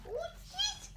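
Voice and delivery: surprised. Real, not acted. Surprise at animal.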